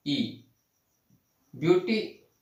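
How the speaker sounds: normal talking voice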